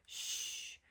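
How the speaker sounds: a sharp whispered shh; breathy shush before the gossip; gossipy shush. Acted. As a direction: hush like a snooty gossip. Gossiping sheep hush.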